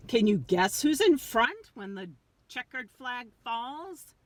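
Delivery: ordinary delivery, nothing marked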